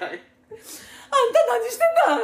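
high, slow, tearful mom; crying mother voice. Retold or imitated. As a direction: wail it like a weeping mother.

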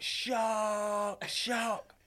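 put-on high, excited kid calling out shark; excited kid shout. Kid on plane shouting to the skaters, high and excited. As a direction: squeal it like a thrilled kid on his first flight.

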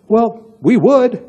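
high, slow, drawn-out put-on reply; mock eager agreement. Prospective couple answering the wife's invite, mock eager agreement. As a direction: play the prospect won over, drawing it out high.

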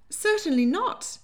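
high, loud, scandalised yelp; shocked denial. A narrator's reading. Lady Hartwood shocked at April's claim, high yelp. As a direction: recoil in shock. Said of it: "looking shocked".

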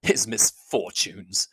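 slow, low and contemptuous; drawn-out scorn on "His misfortunes". Acted. Darcy repeating her words, slow and contemptuous. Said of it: "repeated Darcy contemptuously".

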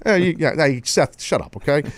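ordinary talk, nothing marked

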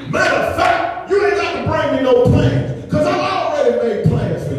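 high-pitched, driving preacher cadence; impassioned preaching. Acted. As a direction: preach it as God's complaint to the people. Preaches in God's voice.

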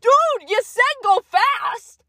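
high, drawn-out protest; acted-out indignant self-defence; retold defensive yell. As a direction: protest loudly in a high, slowed voice. Defending self to injured friend.

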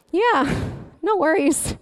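unfazed, easygoing reply after a tirade; casual reassurance. Acted. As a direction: answer as if nothing is wrong. Repeats husband's casual reply.

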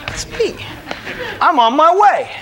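overjoyed, can't wait; eager. Retold or imitated. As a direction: jump at the invitation, laughing. Answering the altar call.

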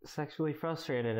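high, drawn-out whiny complaint; mock whining. Mimicking people complaining about lockdown, mock whining.

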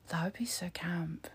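breathless gasp then a whisper. Real, not acted. Vlogger gasps at a funny thought, whispering. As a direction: whisper it like juicy gossip.

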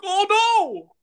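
shrill high panicked squeal; panicked cry. Retold or imitated. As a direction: yelp it out in sudden karaoke panic. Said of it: "I'm like".

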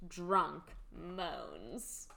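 normal talking voice

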